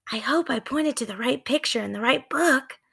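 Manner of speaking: anxious little whisper. Acted. Annie worrying as the wind rises, whispered. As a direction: breathe the worry under the wind.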